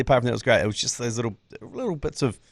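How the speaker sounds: normal talking voice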